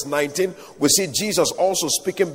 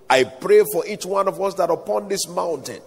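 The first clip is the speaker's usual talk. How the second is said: usual speaking voice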